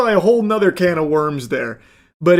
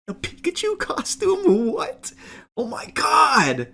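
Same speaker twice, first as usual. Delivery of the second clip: high, hushed fake disbelief; mock astonishment; 8 semitones higher, 4 dB quieter than usual. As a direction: gasp it out as if stunned, sarcastically. Feigned shock at the prank.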